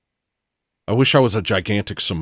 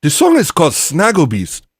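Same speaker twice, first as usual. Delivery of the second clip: emphatic, high mock African accent; 7 semitones higher, 4 dB louder than usual. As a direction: announce it loudly in a put-on African accent. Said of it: "I would use an African accent".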